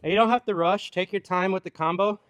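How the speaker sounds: usual speaking voice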